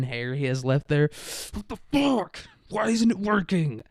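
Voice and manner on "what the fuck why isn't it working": high-pitched, strained cursing rant; furious Putin outburst. Pretend. Putin swearing as the key snaps in the door, high furious yell. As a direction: explode at the broken key, voice shot up high. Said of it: "he's just gonna be like".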